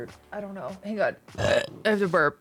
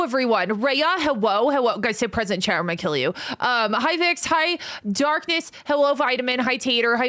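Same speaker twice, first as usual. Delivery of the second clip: ordinary talk, nothing marked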